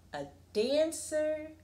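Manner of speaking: usual speaking voice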